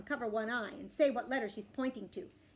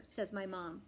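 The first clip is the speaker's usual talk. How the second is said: plain reading voice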